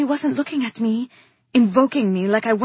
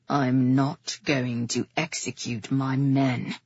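low, slow steely refusal; 9 semitones lower than usual. A narrator's reading. Brother refusing the king's order, steely. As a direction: refuse slowly, low and unyielding. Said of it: "his voice steely".

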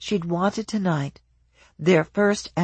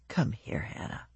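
soft, low beckoning; low drunken murmur; 6 semitones lower, 11 dB quieter than usual. A narrator's reading. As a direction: murmur her name drunkenly. Drunk husband beckons softly.

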